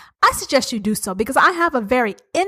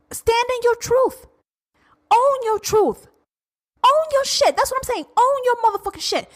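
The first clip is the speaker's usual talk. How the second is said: impassioned; 11 semitones higher than usual. Real, not acted. Heated rant climax.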